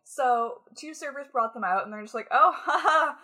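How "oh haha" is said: laughing loudly. Retold or imitated. Servers bringing out cheese.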